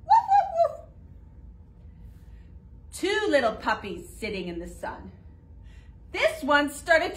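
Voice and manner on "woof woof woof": much higher, louder yapping barks through a laugh; playful high puppy woofs. Acted. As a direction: yap like a little puppy. Puppy in counting rhyme barks.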